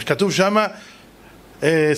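usual speaking voice

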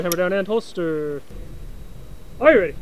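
ordinary talk, nothing marked

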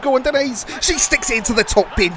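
rousing play-by-play burst; excited goal call. Real, not acted. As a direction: cheer the striker on as it goes in. Calling a goal live.

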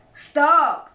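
cried out. A narrator's reading. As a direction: cut him off with a shout. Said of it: "cried Badger".

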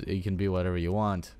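ordinary talk, nothing marked

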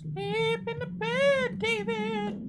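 very high, slow, eerie sing-song; haunt him into bed; mock ghostly voice. Mocking game voice.

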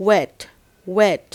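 "wet" spelt V-E-T, said with a w sound; "wet" as an isolated falling word, repeated for pupils; model pronunciation. Shown as a demonstration. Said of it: "จะได้เป็น".

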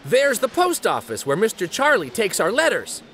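plain reading voice

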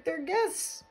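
hammed-up helpless little wail; mock sob. A narrator's reading. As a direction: plead tearfully as a storybook moose. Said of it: "sobbed poor Thidwick".

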